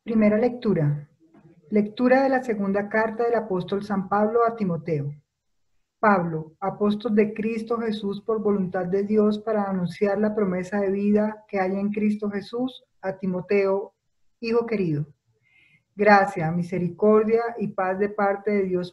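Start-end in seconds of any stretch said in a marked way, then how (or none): none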